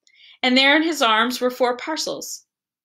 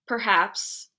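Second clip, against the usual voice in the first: usual speaking voice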